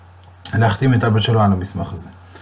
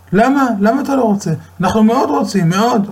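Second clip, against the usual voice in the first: lightly voiced pleading parents, raised higher; 10 semitones higher than usual. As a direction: beg him gently in a high voice. Parents pleading with their son.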